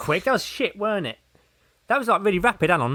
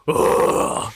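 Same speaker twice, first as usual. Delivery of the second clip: full-on gruff growl; wordless hammed-up superhero growl; Batman; 3 dB louder than usual. Mocking the Dark Knight voice, an over-the-top gruff growl. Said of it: "full on".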